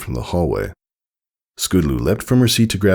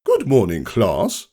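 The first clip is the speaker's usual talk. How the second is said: teacher greeting; 6 semitones higher, slower than usual. Acted. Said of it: "said Missus Meterstick".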